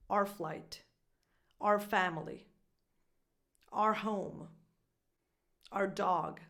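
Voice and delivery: "our" pronounced "ar" in each phrase. Shown as a demonstration. Coach drilling examples for learners, our as R. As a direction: say "our" like the letter R. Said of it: "becomes just an R sound".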